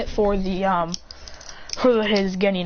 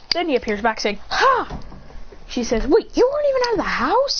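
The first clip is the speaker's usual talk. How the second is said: high, raised astonished voice; high surprised kid voice; 7 semitones higher than usual. Retold or imitated. Sarada finds out her brother is older, high surprised kid voice. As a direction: pitch it way up in disbelief.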